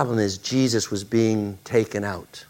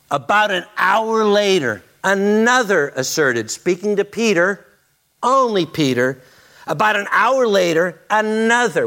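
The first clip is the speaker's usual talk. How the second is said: forceful preaching; 8 semitones higher, 3 dB louder than usual. Acted.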